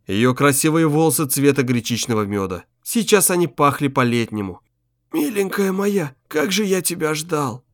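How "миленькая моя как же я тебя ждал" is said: soft, slow and high; tender longing. Acted. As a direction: hold it like a reunion. Old man greets dead wife.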